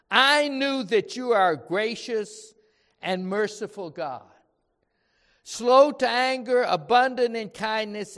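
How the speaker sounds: loud, heated complaint to God; angry Jonah. Acted. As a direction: pour out a bitter protest, loud throughout. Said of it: "It caused Jonah to be very angry".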